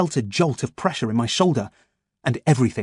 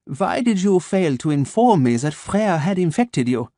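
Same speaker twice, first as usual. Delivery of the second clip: measured German doctor, each word placed; a calm German voice waking his captive; German accent; 6 semitones higher than usual. Acted. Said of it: "his German accent crisp and sharp".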